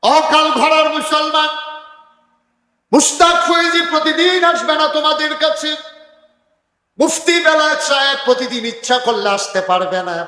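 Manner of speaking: high, loud chanted sermon cry; full-throated waz preaching; preaching at full force. Acted. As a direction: ring it out over the crowd. Preacher addresses Muslims forcefully.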